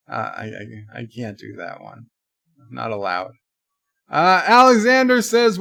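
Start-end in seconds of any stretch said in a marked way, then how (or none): 0.1-3.3 quiet mumble. Real, not acted. Refusing to do Jamaican accent.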